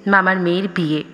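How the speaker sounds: plain reading voice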